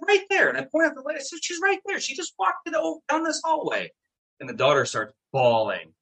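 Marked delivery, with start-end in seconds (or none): 0.1-3.9 much higher, urgently insisting; eager pointing. Retold or imitated. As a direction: point it out, desperate to be believed. Points to portrait of dead lady.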